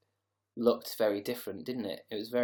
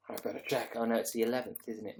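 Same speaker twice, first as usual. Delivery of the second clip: ordinary talk, nothing marked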